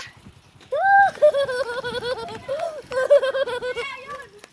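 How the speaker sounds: high burst of laughter; loud laugh. Real, not acted. Laughs at own trick.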